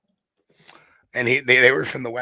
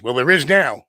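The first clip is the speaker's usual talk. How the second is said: louder, triumphant payoff; punchline; 2 semitones higher, 8 dB louder than usual. Retold or imitated. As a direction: land the reveal with a grin in the voice. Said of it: "He goes".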